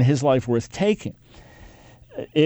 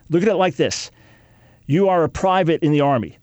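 ordinary talk, nothing marked

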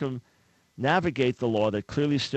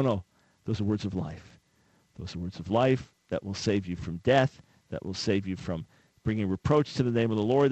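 ordinary talk, nothing marked

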